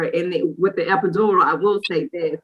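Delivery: normal talking voice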